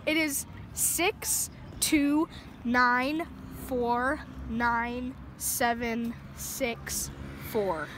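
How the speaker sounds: ordinary talk, nothing marked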